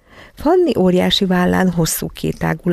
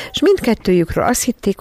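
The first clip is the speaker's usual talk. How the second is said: plain reading voice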